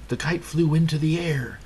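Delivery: ordinary delivery, nothing marked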